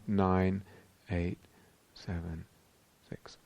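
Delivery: slow countdown fading to very soft; counting back down. Shown as a demonstration. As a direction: count down with the outgoing breath, pausing between numbers. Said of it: "then I turn it around".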